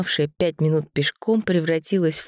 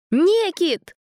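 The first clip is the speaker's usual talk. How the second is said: high loud call of a name; shouting; 13 semitones higher, 4 dB louder than usual. A narrator's reading. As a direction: yell to wake brother. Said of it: "закричал он".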